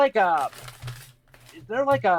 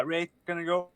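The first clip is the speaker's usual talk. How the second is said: usual speaking voice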